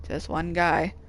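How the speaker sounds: ordinary talk, nothing marked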